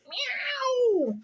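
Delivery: very high drawn-out meow; yowling cat. A narrator's reading. Cora the cat yowls, alarmed by the baby. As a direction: yowl like a startled cat. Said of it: "yowled Cora".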